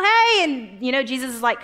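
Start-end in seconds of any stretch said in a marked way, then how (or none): 0.0-0.4 eager call. Retold or imitated. Peter calls to Jesus on water.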